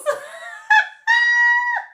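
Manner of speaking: a shrill laugh that tips into a cry; high shrieking laugh. Real, not acted. Reading the channel song to her viewers, high shrieking laugh.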